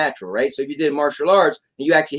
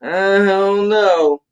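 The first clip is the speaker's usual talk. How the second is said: long-drawn, higher mock refusal; imagined reluctance; 6 semitones higher, much slower than usual. Voicing someone put off by the scenery, drawn-out reluctance. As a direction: stretch it out like a doubtful customer. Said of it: "you might have been like".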